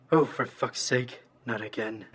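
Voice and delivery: low, soft robotic grumble; deflated muttering. Acted. Villain realizing his accident, deflated robotic mutter. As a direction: curse quietly to yourself in a robot voice. Villain has just wet himself.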